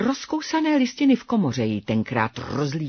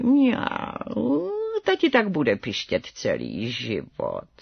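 slow, low, hushed cat mother; meowing mother cat; 6 semitones lower, slower than usual. A narrator's reading. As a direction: meow the words, gliding and stretching them. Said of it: "černá maminka mňoukla".

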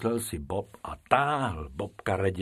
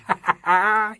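loud keeper's belly laugh, high and loud; hearty belly laugh; 13 semitones higher, 6 dB louder than usual. Acted. Keeper laughing at the rabbits, a loud belly laugh. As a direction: laugh till you double over. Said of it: "smál se, až se prohejbal".

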